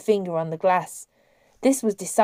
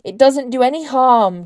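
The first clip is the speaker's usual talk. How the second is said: louder, higher protest; exclaiming; 5 semitones higher, 7 dB louder than usual. A narrator's reading. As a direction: brush it off with a loud exclamation. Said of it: "Julian Adaman exclaimed".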